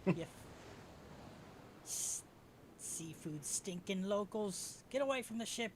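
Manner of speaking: high-pitched jeering insult; acted scornful heckle; hostile sailor. Hostile sailor warns players off.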